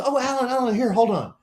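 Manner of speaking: high, urgent retold interruption; retold hurried manager. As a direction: mimic the manager rushing over to stop someone. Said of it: "Manager runs over and says".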